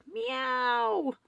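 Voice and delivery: high, long drawn-out meow; cat yowl. A narrator's reading. Cat joins the late-night uproar, yowling. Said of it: "Cora yowled".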